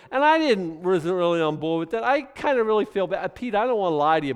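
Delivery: high, loud guilty confession; mock guilty wife. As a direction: blurt a guilty excuse to Peter. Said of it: "wife speaks to Peter".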